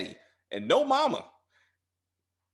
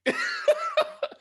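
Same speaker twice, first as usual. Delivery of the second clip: high rising burst of laughter; squeaky falling laugh; 4 dB louder than usual. Real, not acted. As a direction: crack up at the joke. Laughing at own quote.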